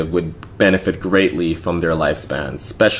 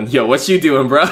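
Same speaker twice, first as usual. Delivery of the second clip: higher, louder bro greeting; breezy raised-voice call; acted-out casual holler; 6 semitones higher, 4 dB louder than usual. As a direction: re-enact bursting in on a friend. Re-enacts walking in on streamer.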